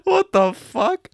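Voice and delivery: high, slow disbelieving cry; stunned. Real, not acted. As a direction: gasp it in disbelief. Reader's stunned reaction.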